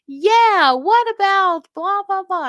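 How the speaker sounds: high, loud imitation of someone riled up; mock angry retort. Woman fired up again by her caregiver's reminder, mock angry. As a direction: shoot back a mocking, high-pitched outburst. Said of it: "first she was furious".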